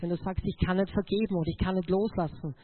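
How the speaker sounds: ordinary talk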